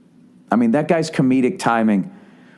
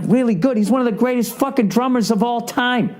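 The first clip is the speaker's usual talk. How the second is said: much higher, outraged disbelief; can't believe she undersold a legend; incredulous excitement; 11 semitones higher than usual. Real, not acted.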